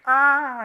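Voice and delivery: high, loud held "aah"; loud imitation of an open-mouthed cry; imitated drawn-out "ah". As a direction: mimic his long, high "aah". Man startled-posing for mistaken photo, imitated.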